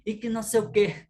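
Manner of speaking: loud, high scolding aunt. Retold or imitated. Aunt scolding the children in a loud, high voice. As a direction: scold the kids like an annoyed aunt. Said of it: "Quando minha tia brigava com a gente".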